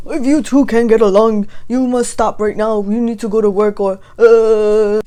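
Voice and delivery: loud, strained scolding voice; imitating the professor. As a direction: bark it like a flustered teacher losing control. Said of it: "Then the professor goes".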